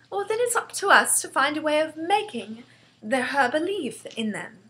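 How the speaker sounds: lightly voiced resolute girl; determined young Sophie. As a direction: reason it out as an earnest child. Sophie argues.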